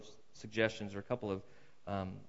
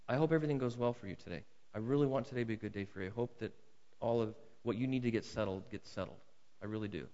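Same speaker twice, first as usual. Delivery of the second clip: softer, fast, earnest well-wishing; sincere goodwill; 3 semitones lower, 6 dB quieter, faster than usual. Acted. To the man suing him in the courthouse hallway, soft and sincere. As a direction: quietly wish your opponent a truly good day. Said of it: "and Jesus says, "In that moment".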